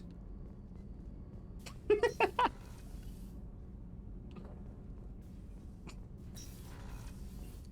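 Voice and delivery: high breathy put-on laughter; fake laugh. Friend asks for fake laugh, high and airy. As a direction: squeeze out a long fake laugh. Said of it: "Is that your fake laugh?".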